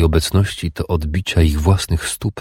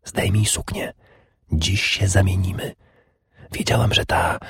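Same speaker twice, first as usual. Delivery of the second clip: whisper; 2 semitones higher, 4 dB quieter than usual. A narrator's reading. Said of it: "zakomendowała szeptem".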